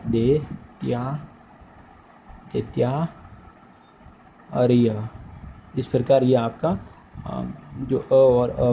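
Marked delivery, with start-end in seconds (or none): 0.1-5.1 दैत्या ... दैत्यार्य dragged out very slowly; very slow syllables. Shown as a demonstration. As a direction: stretch each syllable for the class. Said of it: "तो क्या बनेगा?".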